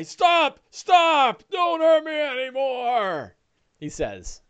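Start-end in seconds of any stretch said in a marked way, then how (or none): 0.0-3.3 high, wailing plea for mercy; frightened ogre. Acted. Wounded ogre begging attackers to stop, high terrified wail. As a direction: beg loudly, stretching the last words. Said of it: "oafish viciousness to fear".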